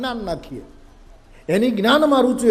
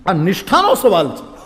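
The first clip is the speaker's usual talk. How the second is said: laughing retelling; 7 dB louder than usual. Retold or imitated. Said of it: "હા".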